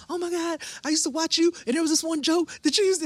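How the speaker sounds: put-on meeting comic she watched as a kid; starstruck gushing. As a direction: gush to your hero. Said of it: "I remember going".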